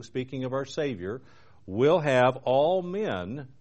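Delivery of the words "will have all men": all said slowly and weighted; stress on all. Shown as a demonstration. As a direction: lean hard on the word all, draw it out. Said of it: "notice the emphasis on the word all".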